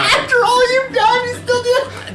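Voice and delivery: very high, hammed-up wail; betrayed girl. Tased girl to her attacker, betrayed wail. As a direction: cry out in mock betrayal.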